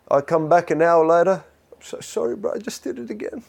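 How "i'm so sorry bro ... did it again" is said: whispered, sheepish apology; hushed guilty confession. Acted. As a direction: confess it again under your breath. Acted sheepish repeat apology.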